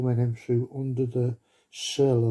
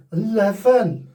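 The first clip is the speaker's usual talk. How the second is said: "Eleven" said high and falling in a Welsh lilt; Welsh accent; 9 semitones higher, 7 dB louder, slower than usual. Pretend. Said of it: "Do we do it in a Welsh accent".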